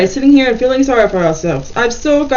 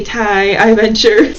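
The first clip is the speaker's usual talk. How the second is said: ordinary talk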